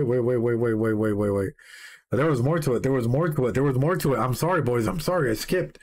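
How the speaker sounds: ordinary talk, nothing marked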